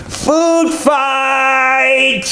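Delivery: high, loud shout; food fight shout. Acted. A sheep kicking off the food fight, loud high shout. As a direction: yell it out to start the chaos.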